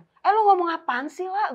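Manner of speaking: high, loud, slow shocked cry; shocked outburst. Retold or imitated. Scolding a friend who says she wants to die, shocked and loud. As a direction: recoil in alarm at her words.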